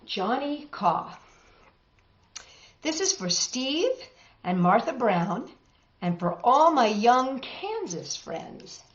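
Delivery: ordinary delivery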